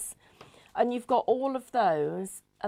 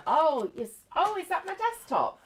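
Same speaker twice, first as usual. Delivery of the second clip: confused lady caller; 8 semitones higher, 5 dB louder than usual. Retold or imitated. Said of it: "retold helpline call, caller".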